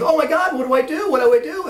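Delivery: high, loud fretting; upset panic. Retold or imitated. Lance realising his blunder to boss, high upset panic. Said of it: "Lance was really upset now".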